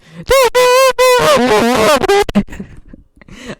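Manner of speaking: high squealing laugh; loud high-pitched laugh; shrieking laugh. Acted. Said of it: "She said".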